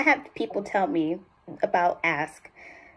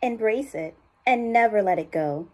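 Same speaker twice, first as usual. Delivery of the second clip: "Embrace" taken a little lower; hopeful tagline in a lower register; lower range; 3 semitones higher, 3 dB quieter than usual. Shown as a demonstration. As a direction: start lower on "Embrace", more real and believable. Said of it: "that lower range".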